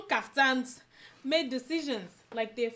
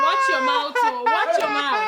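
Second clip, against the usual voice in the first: loud playful scolding; shouting warning; 9 semitones higher, 9 dB louder than usual. Acted.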